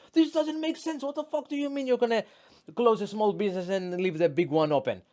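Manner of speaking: high-pitched, fast exasperated complaint; mock outraged rant. Complaining to friends about lockdown rules, high exasperated rant. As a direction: whine it out in disbelief, way up in pitch. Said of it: "I was bitching to my friends".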